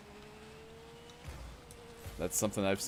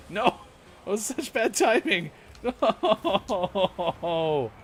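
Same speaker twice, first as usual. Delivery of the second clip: loud, high frustrated cry; frustrated shout; 11 semitones higher, 4 dB louder, much slower than usual. Real, not acted. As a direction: yell at the missed goal. Game goal missed, shouting.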